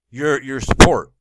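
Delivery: loud emphatic "your support"; loud outburst. Real, not acted. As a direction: belt out the word support.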